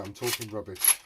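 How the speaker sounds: ordinary talk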